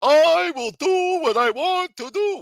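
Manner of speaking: high, loud shouted mock of Edwin, slowing down; mocking whiny quote. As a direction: shriek his words back at him in a mock voice. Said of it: "and say".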